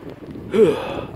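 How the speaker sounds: heavy breathy sigh of giving in; reluctant sigh. Acted. Grudgingly agrees to sing.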